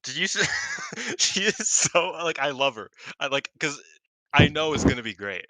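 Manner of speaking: high, loud, gushing fan rush; squealing about a favourite wrestler; excited gushing. Real, not acted. Gushing about Mina's entrance.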